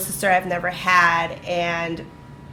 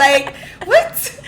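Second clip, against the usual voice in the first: incredulous laughing; 10 semitones higher, 5 dB louder than usual. Retold or imitated. Disbelief at complaints about Myra.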